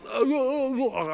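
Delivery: high, wordless sobbing moans; mock speechless blubbering. Weeping dad on the phone to caller, mock blubbering. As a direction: imitate being too choked up to get words out. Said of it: "I'm trying to get words out now".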